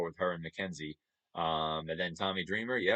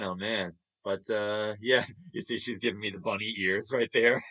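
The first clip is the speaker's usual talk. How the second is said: usual speaking voice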